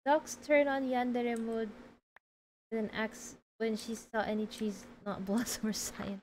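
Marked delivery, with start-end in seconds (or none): none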